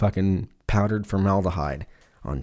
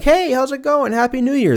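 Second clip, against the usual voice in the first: very high, mock-cheery hello; hammed-up perky greeting; chirpy coworker greeting; 3 dB louder than usual. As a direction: play an over-friendly coworker, pitched way up.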